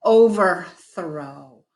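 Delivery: very slow, drawn-out "overthrow"; slow model word. Shown as a demonstration. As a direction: say "overthrow" slowly for a learner. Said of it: "try it again with another word".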